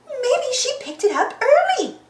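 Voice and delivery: high, hopeful cartoon voice; high-pitched character guess; Daisy character voice. A narrator's reading. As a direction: suggest it in a high voice. Daisy voiced in read story.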